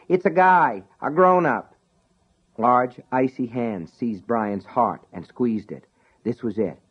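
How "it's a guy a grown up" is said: called back. Acted. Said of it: "Sean called back".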